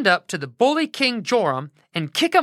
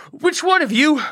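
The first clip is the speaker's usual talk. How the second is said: breathless, higher, louder gasp; out of breath; 9 semitones higher, 4 dB louder than usual. Acted. Intern bursting into the camp, out of breath. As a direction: push it out between pants. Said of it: "out of breath from his long run".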